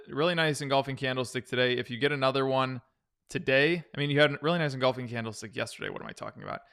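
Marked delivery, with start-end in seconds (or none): 5.9-6.6 muttered aside. Real, not acted. Catching his own mistake aloud.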